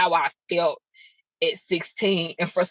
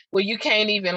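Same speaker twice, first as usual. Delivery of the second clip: ordinary talk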